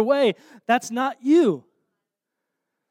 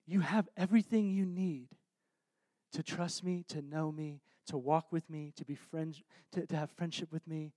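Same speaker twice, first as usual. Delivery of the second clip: very soft, lower, hushed reassurance; tender invitation; 5 semitones lower, 12 dB quieter than usual. Acted. Jesus inviting a listener to walk with him, hushed and tender. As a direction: let each phrase settle quietly, sincere and intimate.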